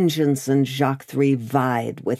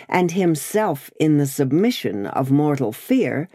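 usual speaking voice

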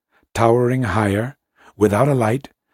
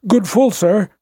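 higher, thin and scared; frightened childlike voice; 10 semitones higher, 4 dB louder than usual. A narrator's reading. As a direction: helmsman answers like a frightened child. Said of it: "in a frightened, thin, childlike voice".

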